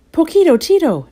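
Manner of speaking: high, loud cry of recognition; delighted exclamation. A narrator's reading. Scapito recognizes his amigo, exclaiming. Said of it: "exclaimed Scapito".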